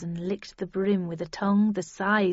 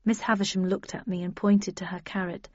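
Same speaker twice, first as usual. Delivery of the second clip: ordinary delivery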